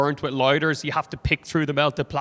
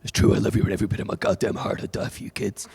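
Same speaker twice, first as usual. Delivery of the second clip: fast, lower American dad voice; 6 semitones lower, faster than usual. Acted. American dad backing up mother, low hurried devotion. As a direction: gush low and quick in an American accent.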